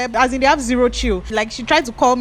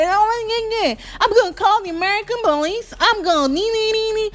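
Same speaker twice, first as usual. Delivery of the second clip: high mocking taunt in a put-on voice; sing-song threat to call the police; mocking Ruth; 13 semitones higher than usual. Ruth taunting the police from her cell, high and mocking.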